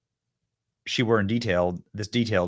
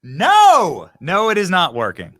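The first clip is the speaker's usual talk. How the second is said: much louder and higher, a flat refusal; 9 semitones higher, 10 dB louder, slower than usual. Real, not acted. Host answering the clip's question, loud emphatic refusal. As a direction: shout it down hard.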